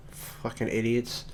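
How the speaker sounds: usual speaking voice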